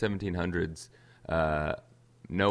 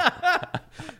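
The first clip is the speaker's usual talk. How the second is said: high burst of laughter; 6 dB louder than usual. Real, not acted. Laughs after line.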